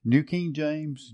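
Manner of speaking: ordinary talk, nothing marked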